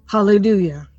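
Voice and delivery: ordinary talk, nothing marked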